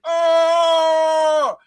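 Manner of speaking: a sudden high yelp of pain, retold; pained yell. Telling friends how his back hurt, a mock pained yelp. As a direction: cry out as if your insides hurt. I was like.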